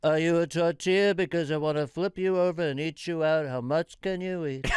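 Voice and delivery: loud Trump voice, brash; loud mock Trump pickup line; Donald Trump impression. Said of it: "Donald Trump".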